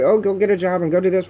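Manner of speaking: high, loud, fast mock of nagging advice; sing-song parody of people who say get a job; mocking quote. Mocking the people who nag her, high, fast and loud. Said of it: "everybody's like".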